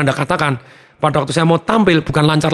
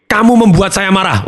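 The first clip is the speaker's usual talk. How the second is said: louder, higher blaming line; mock angry accusation; 7 semitones higher, 3 dB louder than usual. Shown as a demonstration. Coach demonstrating a forbidden sentence, mock angry. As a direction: throw the blame at them, heated. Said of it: "ini kalimat yang pantang".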